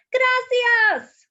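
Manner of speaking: loud, high alarmed call. Acted. Miguel calls his stolen turkey in alarm, loud high call. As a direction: shout after the runaway bird.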